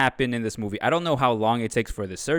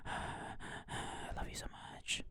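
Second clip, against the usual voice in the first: very soft, slow mock-smitten whisper; sigh, then whisper it slowly; lovestruck whisper; 19 dB quieter, much slower than usual. Smitten Gadget to Brenda after agreeing, mock-adoring whisper.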